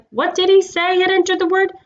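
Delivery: high, excited creature voices; eager gossiping beasts; excited animal chatter. A narrator's reading.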